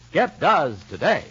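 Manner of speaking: ordinary talk, nothing marked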